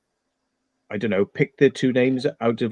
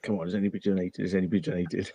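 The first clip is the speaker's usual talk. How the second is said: impatient muttering; 3 semitones lower, 9 dB quieter, much faster than usual. Retold or imitated.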